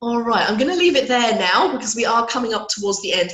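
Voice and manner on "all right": ordinary delivery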